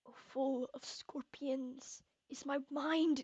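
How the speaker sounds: anguished whisper to his wife; tormented whisper. Acted. Tormented king confides to wife.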